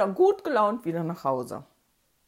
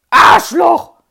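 Arschloch yelled much louder and higher with scorn; contemptuous shout; 8 semitones higher, 20 dB louder than usual. Retold or imitated. Kids yell their favourite insult at the bully. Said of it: "mit so einer Verachtung und Betonung".

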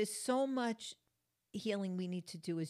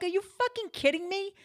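much higher, louder enraged yell; furious outburst; 12 semitones higher, 8 dB louder than usual. Retold or imitated. At the man whose wagon hit her car, furious yell. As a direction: blow up in disbelief. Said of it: "I'm like".

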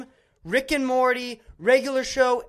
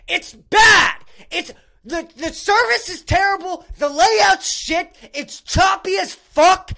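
loud, high-pitched outraged rant; real indignant yelling; angry rant; 8 semitones higher, 7 dB louder than usual. Real, not acted. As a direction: shout it out, fed up and furious. Angry rant about cancellation.

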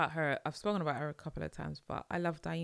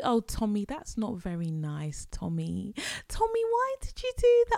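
high, sweet mock scold, rising louder; gentle mum scolding; 5 semitones higher, 5 dB louder than usual. Mocking a gentle parent scolding Tommy, high sweet chiding. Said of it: "They're just like".

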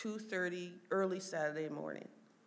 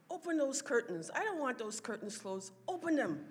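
higher-pitched, insistent retold command; imitated bossy order; 7 semitones higher than usual. Mom ordering nurses to open curtains, bossy. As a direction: act out a demanding patient, pitch raised.

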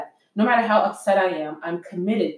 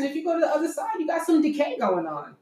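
high-pitched acted sass; comic high voice of blunt disbelief; mock exasperated correction; 7 semitones higher than usual. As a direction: set them straight, hammed-up. Acted blunt answer about wall cracks.

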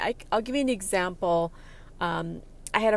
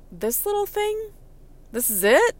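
disbelief; 12 semitones higher, 5 dB louder than usual. Retold or imitated. Disbelief at tiny object.

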